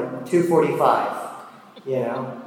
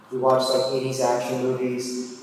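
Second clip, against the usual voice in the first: normal talking voice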